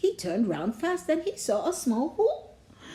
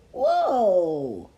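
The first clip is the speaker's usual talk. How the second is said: drawn-out falling whoa; amazed whoa; 3 semitones lower than usual. A narrator's reading. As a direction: marvel at the mess. Narrator marvels at the theft.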